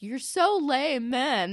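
loud, high, drawn-out jeer; mock teen whine. Ashley brushing off Jimmy's advice, high whiny jeer. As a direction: drag out a high, dismissive whine. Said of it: "And she's like".

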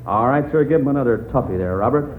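ordinary talk

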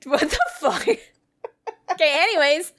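high, laughing through words. Real, not acted. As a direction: try to talk through giggles. Trying to continue while laughing.

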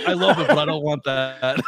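put-on voice breaking into laughter; half-laughed refusal; laughing quote. Bougie answering the joke, with laughter. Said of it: "he's like".

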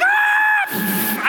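long, very high "aah"; acted falling scream; terrified scream. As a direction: scream as you plummet. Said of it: "But I'm screaming".

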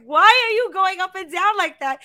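high, loud mock bafflement; incredulous. Daughter teasing mom on phone, high incredulous. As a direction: poke fun at the singsong. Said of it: "And I'm like".

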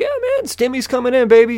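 high, quick, upbeat act; put-on happy. Driver humoring the nice officer, exaggerated happy voice. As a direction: play up the cheer, high and fast. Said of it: "trying to fucking be happy and everything".

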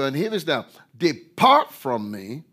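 loud, high preaching climax; high, loud pulpit delivery; fiery preaching. Acted. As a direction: thunder the verses at them. Preaching at full force.

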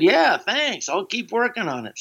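high, bright eager thanks. Retold or imitated. Young comic thanking Spade and Schneider, eager. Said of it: "And I said".

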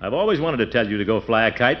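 normal talking voice

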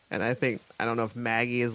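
ordinary talk, nothing marked

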